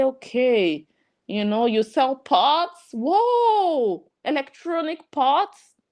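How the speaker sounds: high, hammed-up gasp of wonder; mock amazement. Conference guest pretending to be amazed by pots, high mock wonder. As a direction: play it as an impressed conference guest, overdone. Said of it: "they will say".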